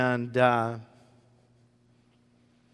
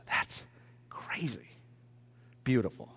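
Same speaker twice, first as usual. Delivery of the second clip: whispered, much softer; hushed amazement; awed whisper; 6 semitones lower, 13 dB quieter than usual. Real, not acted. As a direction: breathe it out in wonder. Awed aside about God's grace.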